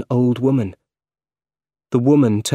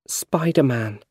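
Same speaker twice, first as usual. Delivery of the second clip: whispered confession; 3 semitones higher than usual. Acted. M J confesses her love.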